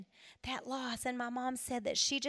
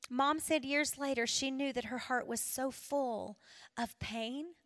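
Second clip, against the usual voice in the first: ordinary talk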